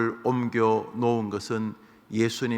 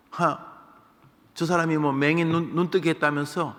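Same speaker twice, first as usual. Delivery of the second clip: sneering; 6 semitones higher than usual. Retold or imitated. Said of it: "그것도 비아냥입니다".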